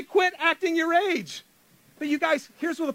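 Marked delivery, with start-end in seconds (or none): 0.0-1.4 higher, slow exasperated parent line; mock fed-up parent scolding a toddler; frustrated parent to a two-year-old, exasperated. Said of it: "you just wanna say".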